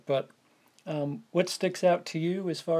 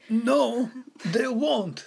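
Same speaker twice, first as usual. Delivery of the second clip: lightly voiced, higher alien junk dealer; a hint of Watto, higher pitched; 7 semitones higher, much slower than usual. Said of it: "My Watto voice".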